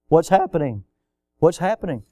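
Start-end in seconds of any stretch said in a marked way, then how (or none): none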